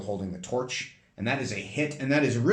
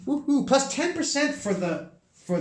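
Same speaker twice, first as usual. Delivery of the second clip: cheering; 3 dB louder than usual. Real, not acted. Cheer at the experience points.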